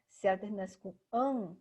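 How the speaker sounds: ordinary talk, nothing marked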